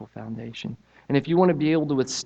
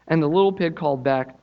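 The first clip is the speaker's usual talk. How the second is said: lively storybook reading, pitched up; story narration; 5 semitones higher, 6 dB louder than usual. A narrator's reading. Preacher reading the pigs story to church, animated. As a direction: tell it like reading to children. Reads children's story aloud.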